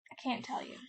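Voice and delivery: hushed whisper of a secret. Acted. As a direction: whisper it so nobody else hears. Sam confessing secretly.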